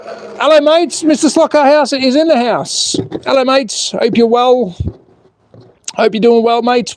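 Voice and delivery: high-pitched, playful hello to the mates; hammed-up greeting. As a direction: greet the viewers in a silly high voice.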